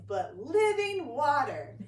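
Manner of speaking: loud, slow, laughing through the words; laughing pastor. Retold or imitated. Pastor to congregation, laughing in disbelief. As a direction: chuckle at how strange it is. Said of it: "he just starts laughing to himself".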